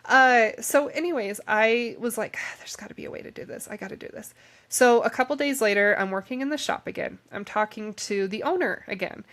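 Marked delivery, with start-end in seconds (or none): 2.4-4.3 quick, whispered self-talk; hushed, breath-only resolve; whispered inner thought. Retold or imitated. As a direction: whisper it fast to yourself. Retold urgent thought to herself.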